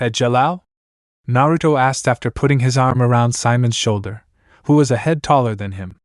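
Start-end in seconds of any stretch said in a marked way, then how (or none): none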